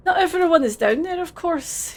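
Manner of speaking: put-on Scottish accent, friendly and a bit overdone; terrible Scottish accent. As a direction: play it as a cheery Scottish lad in a Scottish accent. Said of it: "How can your accent be terrible?".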